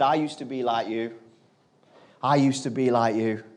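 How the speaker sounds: low, quiet sincerity; soft retold confession, unhurried; heartfelt confiding. Businessman confides in the boy.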